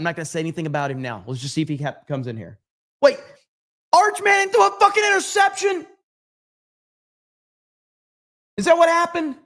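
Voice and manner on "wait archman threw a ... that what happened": high, loud disbelieving yell; shouted outrage at the interception; shocked shout. Real, not acted. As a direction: blurt it out in real shock. Reacting to football game live.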